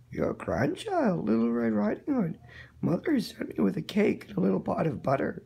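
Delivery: the wolf playing sweet and innocent at the door; wolf faking a child. Wolf at grandmother's door fakes a high child's voice.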